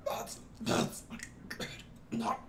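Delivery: strangled, airless choking voice. Retold or imitated. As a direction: choke the words out breathlessly. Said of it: "You're choking a little bit".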